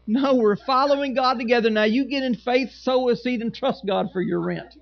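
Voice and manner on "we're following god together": ordinary delivery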